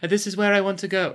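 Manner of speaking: high, quick elf with a touch of British accent; British-tinged elf. Shown as a demonstration. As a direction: raise the voice and sneak in a British accent. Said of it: "still sneaking a British accent in there".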